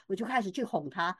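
ordinary talk, nothing marked

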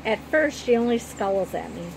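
ordinary delivery, nothing marked

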